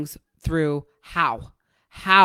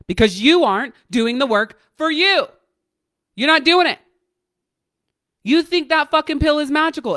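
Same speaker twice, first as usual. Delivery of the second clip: loud and much higher, hammering it home; forceful shout; 9 semitones higher, 7 dB louder than usual. Real, not acted. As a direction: coach shouting at clients that they aren't doing the work, rising to a furious shout. Coach scolding antidepressant users.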